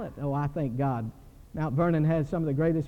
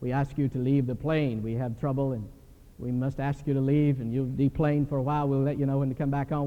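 put-on Scandinavian Schwarzenegger accent; 6 semitones lower than usual. Pilot announcing to passengers to deplane, in a light mock Scandinavian accent. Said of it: "in that Scandinavian Arnold Schwarzenegger voice".

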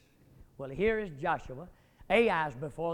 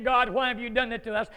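whining outcry; 3 semitones higher, 7 dB louder than usual. Acted. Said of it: "A whining preacher crying out".

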